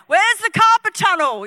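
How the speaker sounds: high, eager acted call. Young preacher on the mic to the crowd, calling out. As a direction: call for the next one to heal.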